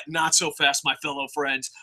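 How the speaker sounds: soft sly reprise of the twist; hushed twist. Retold or imitated. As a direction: say it low and teasing. Same twist line, hushed.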